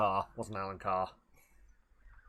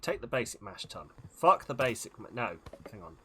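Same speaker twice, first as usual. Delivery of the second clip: ordinary talk, nothing marked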